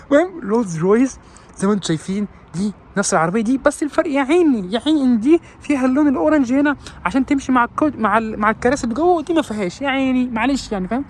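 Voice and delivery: high, pitying car-dealer voice; a high put-on voice of a smug dealer; mock salesman. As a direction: pity the poor customer in a high voice.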